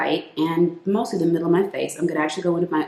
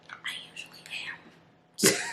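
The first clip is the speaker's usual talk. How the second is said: barely voiced whisper, very soft; hushed, voiceless confession; 11 dB quieter, much faster than usual. Real, not acted. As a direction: drop suddenly into a whisper. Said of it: "I don't know why I'm whispering".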